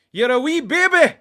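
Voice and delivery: loud, high mock-Scottish taunt; Scottish accent. Fan reviving his Scottish baby jibe, shouted Scottish accent. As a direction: shout it like a jeering Scot. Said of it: "I like that one".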